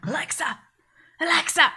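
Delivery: mock yell, building in volume; imitated shouting. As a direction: imitate someone calling angrily at a device. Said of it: "him shouting at the device".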